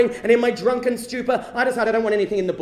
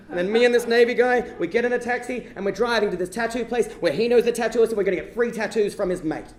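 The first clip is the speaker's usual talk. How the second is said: usual speaking voice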